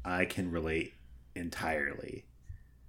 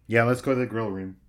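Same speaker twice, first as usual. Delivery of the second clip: ordinary talk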